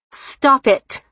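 high, forceful outburst; shouted protest. A narrator's reading. Bride to her mother in the ladies' room, shouting. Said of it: "Marilyn shouted".

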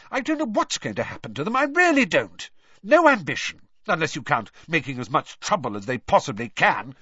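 high, exasperated mother's rant; angry tirade, loud at its peaks; savage outburst. A narrator's reading. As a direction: rail in high-pitched frustration. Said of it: "she burst out savagely".

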